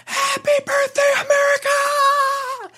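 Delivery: piercing falsetto holler, far above talking pitch; falsetto yell. Shown as a demonstration. Demonstrating the waterfront shout to co-host, falsetto yell. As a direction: whoop it out high, stretching the last word. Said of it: "you yell out".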